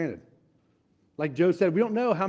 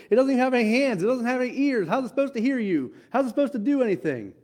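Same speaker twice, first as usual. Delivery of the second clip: mocking idols; 6 semitones higher than usual.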